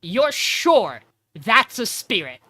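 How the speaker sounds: slow, pointed, skeptical question. Retold or imitated. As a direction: let the doubt hang on each word. Chloe doubts Meg's claim.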